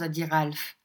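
usual speaking voice

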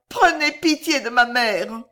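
high, loud begging; distraught plea. A narrator's reading. Girl begging mercy for her mother, high and distraught. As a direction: cry out for mercy. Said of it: "balbutia Violetta éperdue".

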